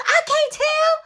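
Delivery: high, loud harsh command; mom yelling. Retold or imitated. Mom forcing daughter to look, high shout. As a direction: order it as the furious mom. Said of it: "mom ordering to look".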